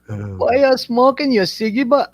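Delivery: high-pitched mock Aussie voice; Australian accent. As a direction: ham up an Aussie question. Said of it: "I like accents, they're funny".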